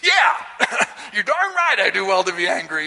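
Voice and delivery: high, indignant retort with a laugh; mock-defiant prophet; defiant Jonah. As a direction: snap back indignantly. Jonah defiantly answering God.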